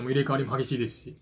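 usual speaking voice

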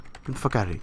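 normal talking voice

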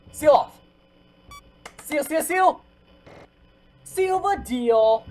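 high, loud frantic orders. Real, not acted. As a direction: bark it out in a rush. Frantically sealing the vent.

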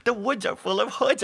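high, weepy fretting; hammed-up anxious blubber; mock whimper. A narrator's reading. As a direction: fret tearfully, easing off from a wail. Todd keeps whimpering.

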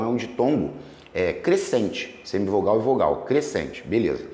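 ordinary delivery

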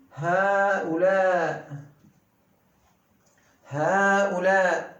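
Hâulâ said slowly with long a's; careful recitation; slow model word. Shown as a demonstration. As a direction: draw out each a of Hâulâ. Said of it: "Hâulâ değil".

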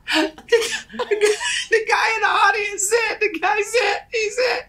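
high, squeaky laughing through words; laughing hard. Real, not acted. Comic retelling his own flop, cracking up. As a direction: struggle to get it out for laughing.

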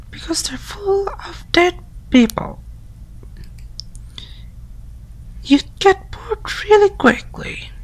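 put-on high, hushed, whispered girly voice; girl voice whispering. Friend whispering spooky morgue facts through the door.

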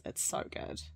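soft unvoiced whisper. Real, not acted. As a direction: breathe it out, barely voiced. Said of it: "I'm really enjoying this".

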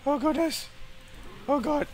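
very high frightened exclamations; real panic; panicked squeak. Real, not acted. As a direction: voice cracks upward in fear. Panicking in the fight.

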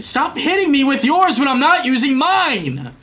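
high, loud complaining; angry yell. Real, not acted. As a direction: yell at the other racers. Yelling at opponents.

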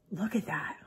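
breathless awed whisper; gasping whisper. Real, not acted. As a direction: gasp then whisper it. Gasps at weird slime.

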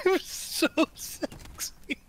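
slow breathy mock seduction; hammed-up sexy whisper. Mock seductive while biting lip.

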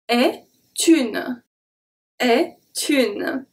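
est une, the T sliding into une; liaison shown. Shown as a demonstration. Teacher breaking down the liaison. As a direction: carry the T of est straight onto une. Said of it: "The T from est is going to be transferred to une".

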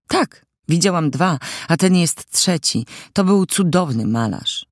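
a hint of an eager young admirer; admiring teenage girl. A narrator's reading. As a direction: lightly play the girl praising a painter.

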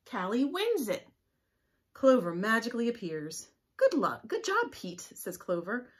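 put-on lively read-aloud for small children; storybook reading. A narrator's reading. Teacher reading a leprechaun story to the class, lively. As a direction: read the tale brightly to the class. Reads a picture book aloud.

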